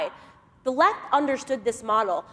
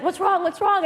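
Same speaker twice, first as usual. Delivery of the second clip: high, urgent What's wrong repeated; imitated panicked boss; 8 semitones higher, 4 dB louder than usual. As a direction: sound like he fears the worst.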